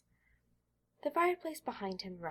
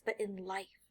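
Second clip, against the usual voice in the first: plain reading voice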